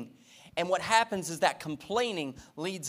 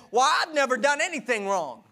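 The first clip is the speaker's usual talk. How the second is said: put-on whiny older son; 8 semitones higher, 4 dB louder than usual. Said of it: "listened to the lies of the enemy and said".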